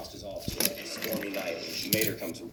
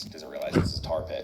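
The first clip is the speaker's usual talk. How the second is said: ordinary delivery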